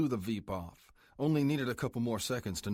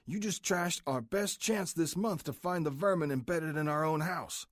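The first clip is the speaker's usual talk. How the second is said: higher, louder angry scolding; harsh angry boss; 8 semitones higher, 3 dB louder than usual. Acted. President scolds his son after slamming him into the wall, angry. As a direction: tear into him for wasting the chance. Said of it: "His voice hit me in a harsh whisper".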